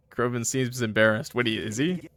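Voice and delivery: plain reading voice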